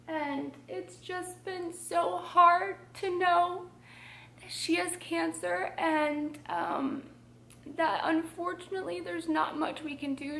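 high, tight, tearful voice with halting pauses; holding back tears. Real, not acted. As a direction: struggle to get it out without crying. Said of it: "I'm gonna try not to cry".

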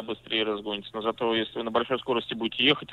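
ordinary talk, nothing marked

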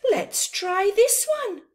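character voice. Acted. Kids' story, character voice.

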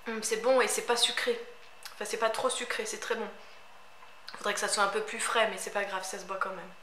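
talking with bottle at lips; tasting while speaking; just after a sip of juice. Real, not acted. Seen 2: Drank at -7 to -5, speaks with bottle rim at lips.

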